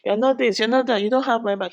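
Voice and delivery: high, indignant break-up rant; mock angry. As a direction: rattle off her grievances, imitating her. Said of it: "She got angry".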